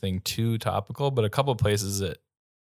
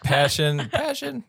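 mock correction; 6 semitones higher, slower than usual. Real, not acted. Mocking correction, laughing.

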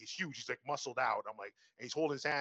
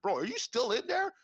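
higher-pitched, incredulous check-in; imitated calling through door; 9 semitones higher, 4 dB louder than usual.

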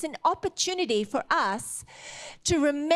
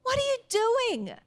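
much higher second asking, rising to an incredulous wail; dismayed outcry; 10 semitones higher than usual. Retold or imitated. Prophet Isaiah confronting King Hezekiah, high aghast repeat. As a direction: ask again, far higher, aghast. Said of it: "Isaiah came back and went".

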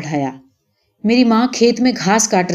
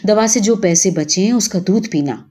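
ordinary delivery, nothing marked

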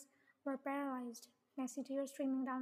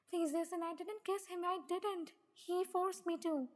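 higher-pitched urgent plea; desperate pleading; 5 semitones higher than usual. Acted. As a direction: plead to be believed, voice raised in pitch. Ginny pleads her innocence.

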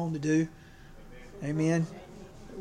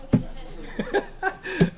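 high, breathy burst of laughter; hearty laugh; 13 semitones higher than usual. Real, not acted. Preacher laughing at his own baby joke, high airy laugh.

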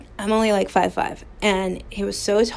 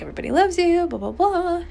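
much higher, mocking sing-song of his comfort; mocking quote; 9 semitones higher, faster than usual. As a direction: parody his empty reassurance in a high voice. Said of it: "And he's like".

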